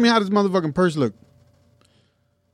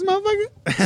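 mock squeal; slower than usual. Teasing steroids joke.

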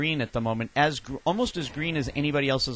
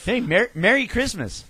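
high, slow toy doll line; talking doll voice; 9 semitones higher than usual. Pretend. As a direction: play the sweet doll voice. Mimicking the doll's voice.

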